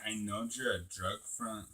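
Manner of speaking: low-key, slow, deliberate accusation; knowing accusation. Retold or imitated. Customer confronting the store worker in a retold scene, knowing. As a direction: say it quietly and pointedly, drawing it out. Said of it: "I told her just like that".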